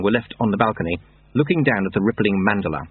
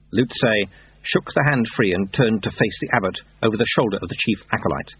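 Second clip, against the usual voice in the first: plain reading voice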